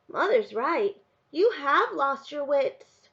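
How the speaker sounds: put-on high, drawn-out child's verdict; singsong little boy telling off his sister; scolding little brother. A narrator's reading.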